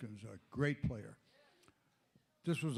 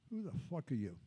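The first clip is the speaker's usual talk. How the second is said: a hint of a cold star snubbing a fan; muttered brush-off, softer and flat; Michael Jordan's gruff brush-off; 9 dB quieter, faster than usual. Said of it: "Like that".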